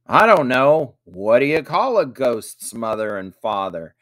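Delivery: emphatic stock reply to a riddle; repeating the riddle. A narrator's reading. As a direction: hand the riddle back with a big "I don't know". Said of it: "repeated Jack".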